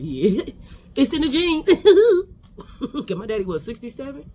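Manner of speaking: slow, laughing through the words; amused and drawn out with a laugh. Real, not acted. Laughing about daddy's age.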